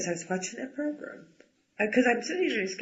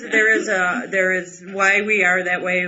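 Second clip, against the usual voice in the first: ordinary talk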